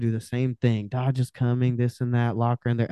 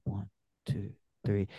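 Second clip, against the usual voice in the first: hushed count; 4 semitones lower, 10 dB quieter than usual. Shown as a demonstration. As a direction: count low and soft, almost breathed. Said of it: "And I'll do that".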